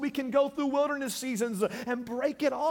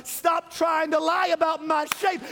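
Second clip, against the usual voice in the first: preaching shout; 8 semitones higher, 5 dB louder than usual. Acted.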